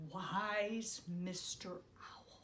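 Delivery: slow unvoiced whisper; hushed whisper. A narrator's reading. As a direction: whisper in awe. Hushed awed naming.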